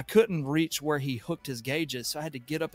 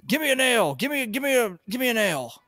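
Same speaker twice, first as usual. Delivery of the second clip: loud, much higher hammed-up yell; mock yell; 10 semitones higher, 6 dB louder than usual. Cranky plumber hollering for a fitting, mock yell. Grumpy plumber demanding tool.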